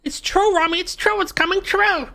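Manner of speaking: excited high voice. Acted. Excited at prophecy.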